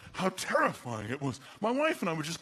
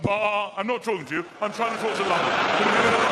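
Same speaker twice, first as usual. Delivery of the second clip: loud, rising yell at the sheep; frustrated shout; 3 semitones higher, 8 dB louder, faster than usual. Retold or imitated. Comedian shouting over sheep to reach London, loud. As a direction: bark at the sheep, then the phone.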